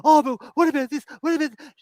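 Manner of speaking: high, loud mocking whine; mocking the blonde woman talking to the governor, high whine; mocking whiny woman. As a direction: mimic her pushy whining. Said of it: "talking to the governor going".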